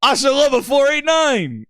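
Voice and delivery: high, drawn-out mock proclamation; adoring gamertag fan. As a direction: belt the fan name high and proud. Another fan announces gamertag.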